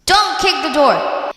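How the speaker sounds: husband's high-pitched shout of warning. Retold or imitated. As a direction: raise your voice to scold a kid. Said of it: "raising his voice".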